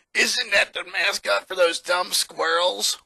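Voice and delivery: put-on sneering kid with a laugh; a lightly voiced bully jeering at the mascot; mocking bully. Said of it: "one of them said".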